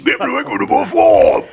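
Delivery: high, loud hammed-up Australian farm girl; mock Australian. Imitating Rachel Ward for the co-host, high loud mock Australian. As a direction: shout it in a cartoon Australian voice. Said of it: "No, she sounds like this".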